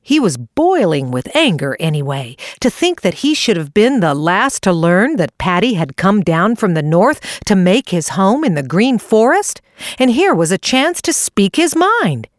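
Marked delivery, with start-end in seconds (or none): none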